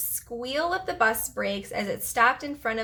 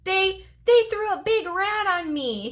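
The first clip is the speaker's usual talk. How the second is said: high, wobbly, close to crying; near tears; 9 semitones higher, 3 dB louder than usual. Acted. Marty complaining to the bus driver, almost crying. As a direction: stammer it out almost weeping. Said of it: "He was almost in tears".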